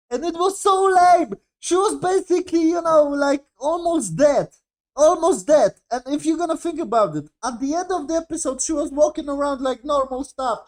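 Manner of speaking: exasperated rant. Real, not acted. Exasperated rant about the plot.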